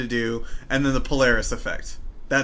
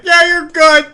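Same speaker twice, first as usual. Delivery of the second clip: much higher and louder, careless lab-coat guy; lazy fat doctor; 14 semitones higher, 12 dB louder, slower than usual. Pretend. Fat doctor at his desk clears the soldier, loud high brush-off. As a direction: brush him off like a slob behind a desk. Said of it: "this giant fat guy, like, Newman".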